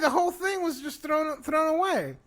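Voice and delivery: high-pitched, worked-up complaint; exasperated protest. Real, not acted. Fan arguing with friend over pointless death, exasperated.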